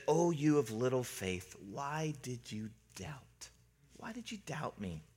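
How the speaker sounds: very soft, slowing to near whisper; hushed rebuke to a shaken friend; gentle reproach. Retold or imitated. Jesus gently reproaching rescued Peter.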